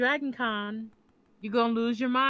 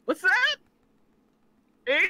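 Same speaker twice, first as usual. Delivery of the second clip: mock perked-up ears; 7 semitones higher than usual. Teasing her friend about his ears perking up at pretty women. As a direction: play the eager perked-up listener.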